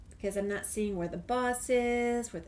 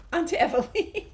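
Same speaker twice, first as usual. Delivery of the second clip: laughs through the words; giggling as she heads on; laughing; 7 semitones higher, 3 dB louder than usual. Real, not acted. To the viewers, laughing while moving on.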